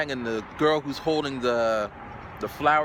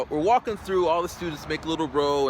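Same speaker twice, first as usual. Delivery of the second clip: ordinary delivery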